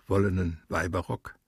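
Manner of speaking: usual speaking voice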